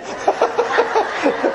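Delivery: high, loud burst of real laughter. Real, not acted. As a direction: break up laughing at your own joke. Laughs at Baptist evangelist joke.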